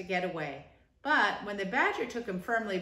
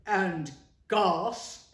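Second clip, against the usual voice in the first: louder, gruff blurt; common badger; 6 dB louder than usual. Badger interrupts Rat bluntly, common gruff. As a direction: bark it in like a plain countryman. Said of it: "in his common way".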